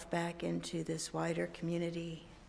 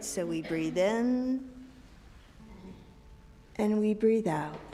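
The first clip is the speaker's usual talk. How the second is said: slow soothing breathing cue; guided breathing. Shown as a demonstration. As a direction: lead the breath slowly. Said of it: "remind ourselves of how it goes".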